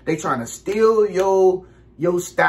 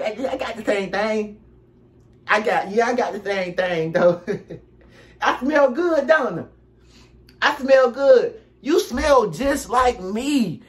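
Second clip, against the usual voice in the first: lightly voiced copycat, higher and showing off; copycat friend; 5 semitones higher than usual. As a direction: play the copycat bragging he smells the same. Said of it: "they gonna show you".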